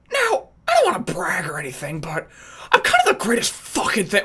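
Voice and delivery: put-on cocky braggart voice; friend as narcissist. Attempting an original voice on challenge, narcissistic friend. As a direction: brag it like a puffed-up narcissist. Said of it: "that's an imitation of my friend Matt".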